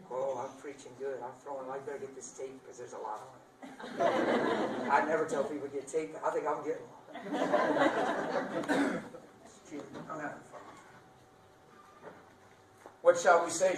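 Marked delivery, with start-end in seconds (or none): 3.6-4.9 laughter. Real, not acted. Preacher laughs. 7.1-9.5 laughter. Real, not acted. Preacher laughs.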